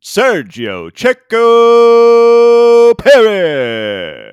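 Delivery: screaming, drawn-out arena announcer. Retold or imitated. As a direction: bellow the name like a stadium announcer. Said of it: "He was screaming".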